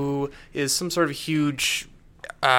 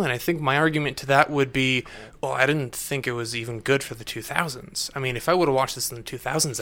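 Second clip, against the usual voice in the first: ordinary talk, nothing marked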